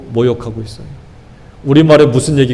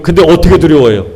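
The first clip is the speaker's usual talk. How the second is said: ordinary talk, nothing marked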